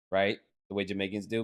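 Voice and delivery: ordinary talk, nothing marked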